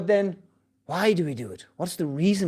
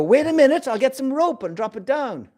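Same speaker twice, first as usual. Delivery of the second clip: calling down; 8 semitones higher than usual. Retold or imitated. Rescuer calling down.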